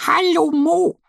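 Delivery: a tiny duck waving hello from the treehouse; Annie calling. A narrator's reading. Annie greets her friend Mo from above, high happy call. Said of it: "ruft Annie".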